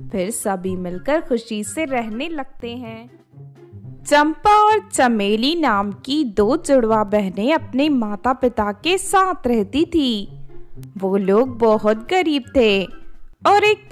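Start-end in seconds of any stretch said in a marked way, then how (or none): none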